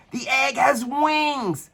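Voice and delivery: high, slow amazed cry; astonished shout. A narrator's reading. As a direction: marvel at the egg taking flight. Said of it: "shouted Sir Tyrone".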